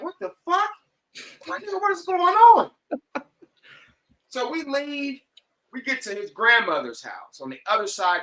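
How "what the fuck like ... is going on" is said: high, loud bewildered outburst; stunned disbelief. Retold or imitated. In the car as his friend tosses a stolen dog in, high shocked outburst. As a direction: blurt it out in shock, pitched way up. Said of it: "And I'm like".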